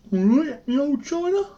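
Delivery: very high-pitched comic voice; silly high voice. Pretend. Phone reviewer joking with an old China, silly high voice. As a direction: put on a playful falsetto squeak. Jokey put-on voice to viewers.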